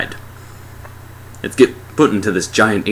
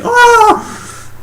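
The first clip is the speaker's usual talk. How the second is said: short high, loud comic scream; panicked scream; 6 dB louder than usual. Acted. Reviewer voicing the other car's reaction, panicked scream. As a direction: yelp like a driver in sudden panic.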